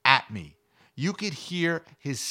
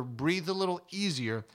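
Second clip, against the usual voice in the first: ordinary delivery, nothing marked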